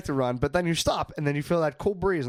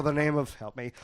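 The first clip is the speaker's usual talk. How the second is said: ordinary talk, nothing marked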